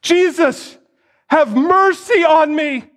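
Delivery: high, desperate cry for mercy. Acted. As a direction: call out over the crowd, begging. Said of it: "starts crying out".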